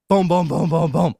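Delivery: loud, rapid run of booms; punchy booms like heavy knocks; imitated pounding. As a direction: mimic someone hammering on a door. Imitating pounding on back door.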